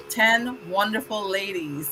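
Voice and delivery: ordinary talk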